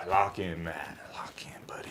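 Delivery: very soft, trailing into a whisper; under the breath, fading to a whisper; muttered self-pep-talk. Real, not acted. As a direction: quietly coach yourself to hold it together.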